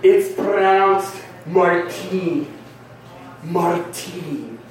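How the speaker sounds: slow "Martine" with a long stressed second syllable; pompous name correction. Retold or imitated. Dude correcting his own name, slow and precious. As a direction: correct them, stretching out the end of Martine. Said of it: "And then he goes".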